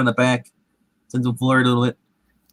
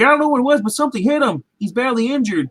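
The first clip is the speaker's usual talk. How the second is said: panicked hunter; 7 semitones higher, faster than usual. Retold or imitated. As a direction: voice jumps higher, alarmed and rushed. Hunter panicking over injured friend.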